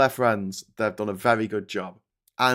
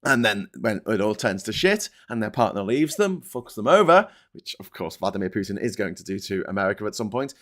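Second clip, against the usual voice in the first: normal talking voice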